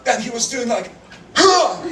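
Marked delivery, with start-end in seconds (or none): 1.4-1.8 short high huff, louder; high effortful "huh"; effortful lunge noise. Retold or imitated. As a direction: mimic a second lunging grab. Imitates second failed grab.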